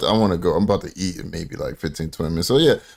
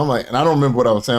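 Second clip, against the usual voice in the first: normal talking voice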